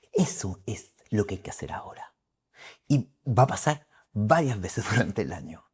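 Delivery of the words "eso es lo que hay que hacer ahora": hushed advice; whisper. Real, not acted. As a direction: whisper it close and confidential. Consejo susurrado. (consejo en susurro)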